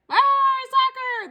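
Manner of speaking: very high, loud cheer; the crew shouting along; crew yell. Acted. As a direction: crew echoing the captain. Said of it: "the crew yelled".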